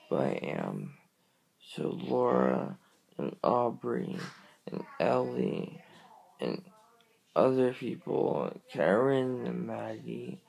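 ordinary talk

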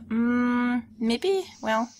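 normal talking voice

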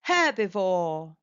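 ordinary delivery

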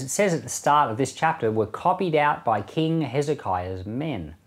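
ordinary delivery

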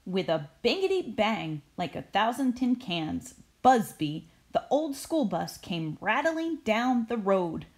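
storybook reading voice; story narration. A narrator's reading. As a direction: read it like a bedtime picture book. Reads story aloud.

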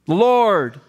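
normal talking voice

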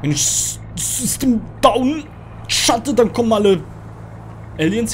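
stammer, then wail the words out; panicked objection. Real, not acted. Player arguing with the game's instruction, panicked objection. Argues against shutting system down.

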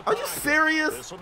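high, incredulous question; can't believe how much time he got; disbelief. Real, not acted. Gamer to viewers, stunned at the replay, high disbelief. Watching the quarterback's extra time.

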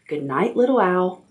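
ordinary delivery, nothing marked

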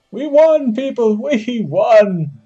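high, loud victory cry; triumphant cheer. Real, not acted. Gamer announcing the win to viewers, triumphant cheer. Team has just won the match.

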